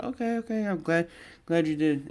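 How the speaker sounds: put-on high-pitched girlfriend voice; girlfriend imitation. Girlfriend answering his safe-arrival text, high imitated voice.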